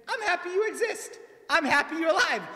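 high, bright voicing of joyful guests; happy party guests. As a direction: sound like a glad friend at a birthday. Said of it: "A bunch of people get together and say".

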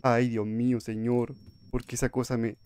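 normal talking voice

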